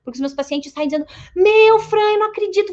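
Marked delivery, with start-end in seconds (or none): 1.3-2.7 high, loud amazed exclamation; imitating an amazed patient. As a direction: burst out like a stunned patient. Patients leave amazed, quoted.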